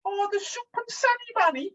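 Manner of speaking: very high-pitched comic Scottish accent; best-effort Scottish accent. Shown as a demonstration. Having a go at the van name in Scottish, put-on Scottish accent. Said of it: "I'll do my best Scottish".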